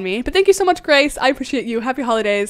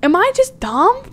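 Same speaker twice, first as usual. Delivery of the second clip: ordinary talk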